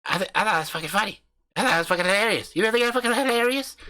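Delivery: brash country husband hyping up his channel; cocky backwoods YouTuber swaggering through the intro; crude hillbilly husband. Acted. Husband insists it's funny.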